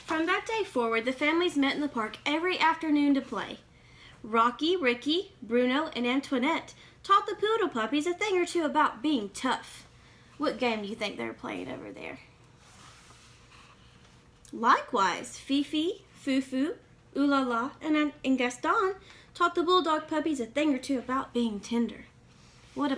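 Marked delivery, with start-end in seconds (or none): 0.0-9.9 put-on lively read-aloud for kids; storybook reading. A narrator's reading. Reading a picture book to young listeners, lively storytelling. 14.5-22.1 put-on lively read-aloud for kids; storybook reading. A narrator's reading. Reading a picture book to young listeners, lively storytelling.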